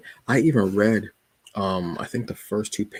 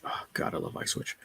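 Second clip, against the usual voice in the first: whispered gush; 11 dB quieter than usual. Real, not acted. Gushing aside.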